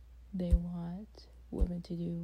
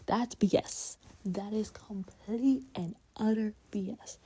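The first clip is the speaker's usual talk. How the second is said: real anger, louder and rising; getting angry; 3 semitones higher, 6 dB louder than usual. Real, not acted. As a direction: let the frustration boil over into the words. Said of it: "here's me getting angry".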